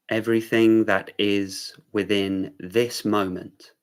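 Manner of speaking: unhurried meditation-teacher delivery; guided meditation. Real, not acted. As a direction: guide them gently into awareness, leaving room between phrases.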